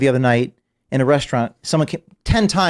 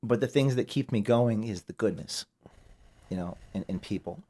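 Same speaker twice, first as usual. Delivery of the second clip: quiet, fighting back tears; choked up; 6 dB quieter than usual. Real, not acted. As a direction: voice dropping soft with emotion. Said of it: "I'm gonna get emotional here".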